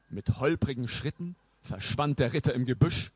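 usual speaking voice

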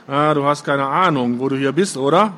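ordinary delivery, nothing marked

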